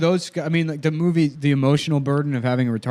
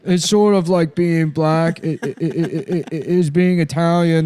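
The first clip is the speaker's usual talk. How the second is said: higher, stuttering movie character; Giovanni Ribisi; 4 semitones higher than usual. Acted. Boyfriend explaining being Italian, hammed-up stutter. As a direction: get stuck on words, then drag them out. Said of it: "Giovanni Ribisi, who's just, like, Italian".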